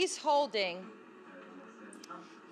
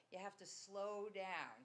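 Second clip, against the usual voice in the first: soft slow instruction; 12 dB quieter, slower than usual. Retold or imitated. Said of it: "what she's saying is".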